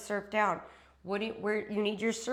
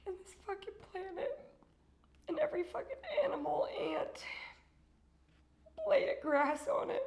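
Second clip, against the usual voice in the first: tearful; 8 semitones higher than usual. Real, not acted. Emotional thanks to viewers.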